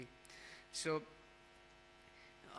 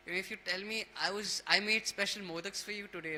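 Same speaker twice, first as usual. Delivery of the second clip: higher, louder retold coaxing; lightly voiced mother tempting her child with a treat; 4 semitones higher, 6 dB louder than usual. Parvati bribing Ganesha with special modaks, coaxing.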